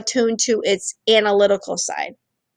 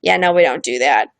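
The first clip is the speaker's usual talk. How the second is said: firm retold shutdown; 7 semitones lower than usual. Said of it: "I was like".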